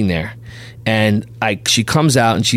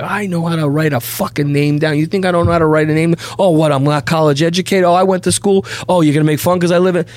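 mock angry rant; 6 semitones higher than usual. Son berates his mother.

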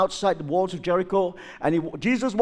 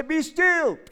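mock command; 9 semitones higher than usual. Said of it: "lift up your hand and say".

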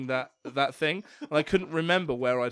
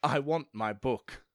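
slow deliberate quote; 3 semitones lower, slower than usual. Retold or imitated. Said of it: "She goes".

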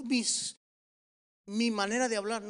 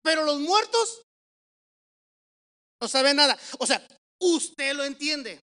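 loud pulpit proclamation; emphatic scripture preaching; 3 semitones higher, 7 dB louder than usual. Real, not acted. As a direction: drive the verse home forcefully. Preacher reads and applies scripture.

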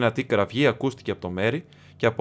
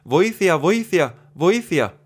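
higher, louder desperate cries; shouting for help; 7 semitones higher, 5 dB louder, much slower than usual. A narrator's reading. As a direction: call for help down the path. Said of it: "έτρεξε στο μονοπάτι φωνάζοντας". (κραυγές για βοήθεια, δυνατά και ψηλά)

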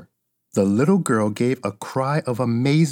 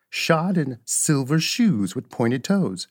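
plain reading voice

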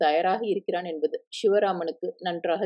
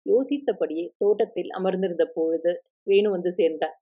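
usual speaking voice

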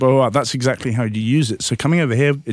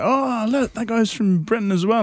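eager pointing out; 11 semitones higher than usual. Retold or imitated. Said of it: "someone's like".

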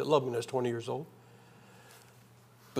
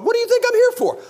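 much higher, louder exasperated outburst; steamed; 6 dB louder than usual. Retold or imitated. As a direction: blurt the frustration out high. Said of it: "getting a little steamed".

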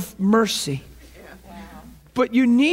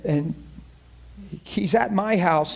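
normal talking voice

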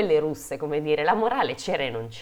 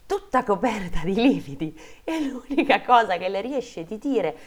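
animated fairy-tale reading; dramatic story narration; 3 semitones higher than usual. A narrator's reading. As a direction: tell the grim part like a storyteller. Reads a fairy tale aloud.

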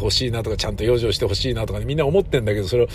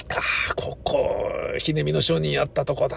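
choked-up recall; 3 semitones higher, 5 dB quieter than usual. Retold or imitated. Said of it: "つって".

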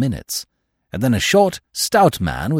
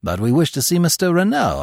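higher-pitched protest; crying out; 7 semitones higher than usual. A narrator's reading. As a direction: cry out in mild protest. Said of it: "I cried".

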